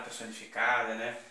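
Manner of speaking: ordinary talk, nothing marked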